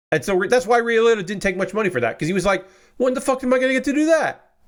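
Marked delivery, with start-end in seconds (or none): 3.0-4.5 excited outburst. Retold or imitated. Liotta's imagined excited outburst.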